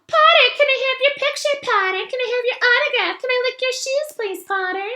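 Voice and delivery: high, mocking fanboy squeal; mock the eager little fan, high and fast; cruel impression of Colin. Malfoy mocks Colin to his cronies, squeaky fawning voice. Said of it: "a cruel but accurate impression of Colin".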